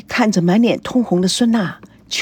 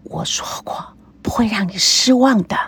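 whispered in her ear. Acted. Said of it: "在孙娜的耳边轻轻地说".

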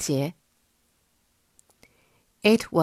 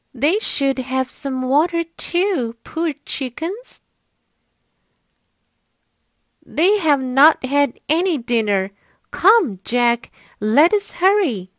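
put-on high, louder caring little girl; a little girl fussing over chickens; 8 semitones higher, 5 dB louder than usual. Molly pities the chickens.

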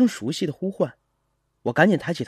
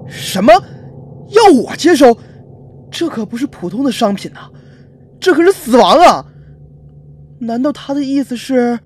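loud, high panicked protest; frightened, incredulous outburst; terrified realization; 7 semitones higher, 10 dB louder than usual. Acted. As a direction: cry out in alarm as it dawns. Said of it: "不由吓得全身汗毛竖了起来".

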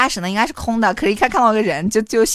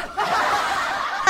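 laugh; 10 semitones higher, 6 dB quieter than usual. Real, not acted. Laughs at own story.

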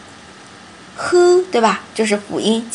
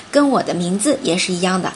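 ordinary talk

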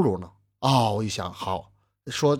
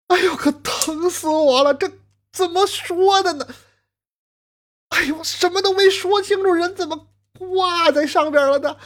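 much higher, keening cry of grief; wailing lament. Acted. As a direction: sob out the lament over the body. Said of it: "就这个哭啊". (丈夫抱着尸身哭诉，高声哀嚎)

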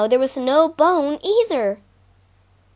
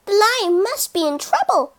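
high, determined little mouse; 6 semitones higher than usual. Acted. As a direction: resolve in a tiny voice. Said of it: "she thought".

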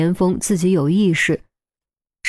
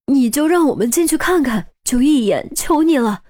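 raised, urgent begging mother; anxious pleading; 7 semitones higher than usual. Acted. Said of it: "赵千霞急了".

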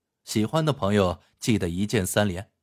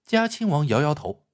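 ordinary delivery, nothing marked

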